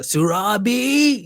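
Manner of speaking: drawn out, rising high, playful film call; Lion King call. Retold or imitated. Host playing on the guest's name, drawn-out Lion King call. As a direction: stretch it out and swoop up high like a Lion King cry. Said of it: "from, from Lion King".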